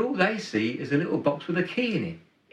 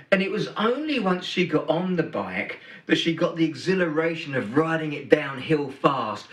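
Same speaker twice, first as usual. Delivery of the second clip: usual speaking voice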